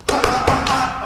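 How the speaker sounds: punch sounds. Shown as a demonstration. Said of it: "coach demos punches with sounds".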